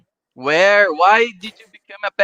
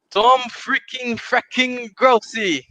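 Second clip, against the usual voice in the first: slowed, drawn-out hype intro; announcer-style intro; 5 semitones higher, slower than usual. Acted. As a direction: lean into each word like a ring announcer, a little higher. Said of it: "heard someone do my intro".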